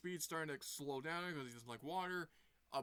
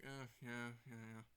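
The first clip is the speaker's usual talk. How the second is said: sheepish officer; 4 semitones lower, 7 dB quieter than usual. Retold or imitated.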